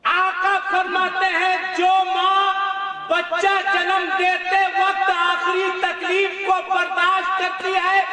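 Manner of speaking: high, loud, rhythmic sermon; full-force preaching. Real, not acted. Preacher extolling the mother's rank to the crowd, fervent preaching. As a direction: preach it with rising fervour.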